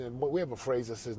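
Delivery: ordinary talk, nothing marked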